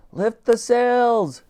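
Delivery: high, very slow called order; high, drawn-out ship's order; calling out. A narrator's reading. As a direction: stretch the command to the crew. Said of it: "she called out".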